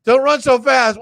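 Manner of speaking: loud call after someone. Real, not acted. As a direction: shout after him as he leaves. Calling after someone leaving.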